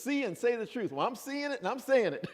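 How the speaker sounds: high, loud playful gloat; mock defiance. Teasing the class that she is telling truth, in high mock defiance. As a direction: tease the class triumphantly. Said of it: "playful mock defiance".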